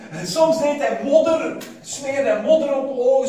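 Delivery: loud, high preaching build; preaching loudly. Acted. Preacher to congregation about healing, loud and high.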